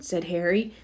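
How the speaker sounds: ordinary delivery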